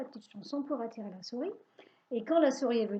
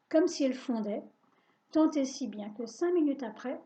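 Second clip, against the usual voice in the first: usual speaking voice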